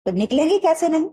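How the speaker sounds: high, indignant challenge from the father; the father refusing to give up, pitched high; defiant father. Acted. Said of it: "पिताजी बोले".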